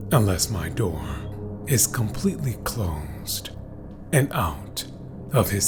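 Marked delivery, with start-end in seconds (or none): none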